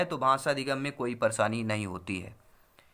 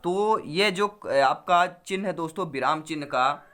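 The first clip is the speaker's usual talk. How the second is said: ordinary delivery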